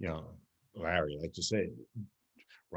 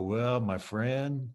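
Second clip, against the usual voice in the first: slow calm line. Shown as a demonstration. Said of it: "look over at him and go".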